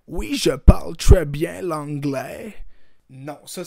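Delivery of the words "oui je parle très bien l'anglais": put-on French said with a loud, slow English accent; mock anglophone accent in French. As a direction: fake an English accent, slowly. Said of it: "c'est juste un accent anglais".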